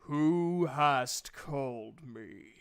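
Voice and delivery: the summoned god demands who called him, slow and booming. Acted. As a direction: boom it out like an ancient knight spirit. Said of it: "you hear a voice go".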